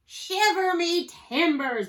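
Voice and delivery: high, loud, stretched-out oath; pirate bellow. A narrator's reading. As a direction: bellow it slowly in pirate outrage. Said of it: "bellowed Pete".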